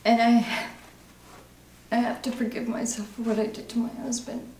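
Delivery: distraught, halting admission of guilt; anguished recovering alcoholic. Acted. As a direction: struggle through it in broken phrases. Acting exercise, confession continues after coach note.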